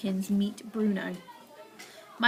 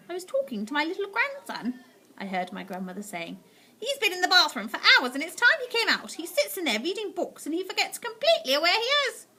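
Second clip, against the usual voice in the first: put-on higher, easy old lady voice; cool grandmother; 8 semitones higher than usual. A narrator's reading. Grandmother coolly explaining the knitting to the witch. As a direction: stay perfectly unbothered.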